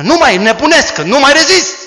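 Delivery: high, loud urgent pressure; desperate plea. Acted. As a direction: beg loudly, claiming he can't hold back. Boy voiced pressuring a girl.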